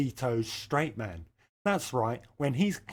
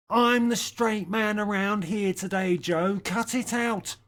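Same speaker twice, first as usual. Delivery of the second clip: higher put-on voice telling Joe off; mock scolding; 7 semitones higher than usual. Telling Joe to cut it out, mock scolding. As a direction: scold him like a fed-up partner.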